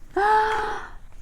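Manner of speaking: sharp high surprised gasp. A narrator's reading. Narrator gasping as the broom snaps. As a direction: gasp in alarm.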